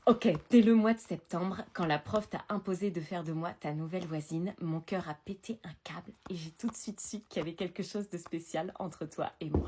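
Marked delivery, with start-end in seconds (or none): 2.5-9.7 whispering. Acted. Reading love letter.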